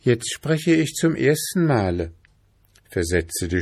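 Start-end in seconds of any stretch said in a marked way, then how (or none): 0.0-2.1 put-on high, eerie girl's voice; eerie princess voice.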